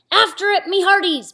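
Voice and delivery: high loud order; pirate command. Acted. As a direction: command the crew to chase. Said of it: "Braidbeard commanded".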